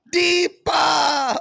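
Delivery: high, loud imitated yell; mock yell. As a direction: bellow it like the pastor in the video. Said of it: "when he yells".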